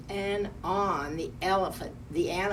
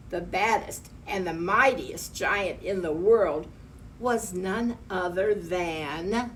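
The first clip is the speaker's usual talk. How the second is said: plain reading voice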